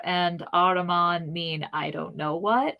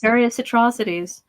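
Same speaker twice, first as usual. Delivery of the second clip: usual speaking voice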